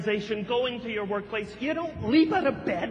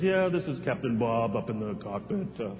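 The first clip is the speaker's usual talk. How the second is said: droning low captain voice; flat lazy pilot on the PA; monotone pilot; 14 semitones lower, 4 dB quieter than usual. Retold or imitated. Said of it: "he sounds very, you know, monotone at first".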